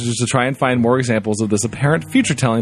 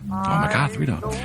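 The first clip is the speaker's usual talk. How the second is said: soft, under-the-breath aside; hushed aside; 6 semitones higher, 7 dB quieter, slower than usual. Acted. Reader reacting to the radio announcer's name, hushed aside. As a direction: drop it right down, almost to yourself.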